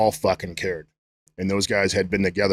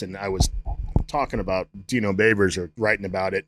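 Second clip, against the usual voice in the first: ordinary talk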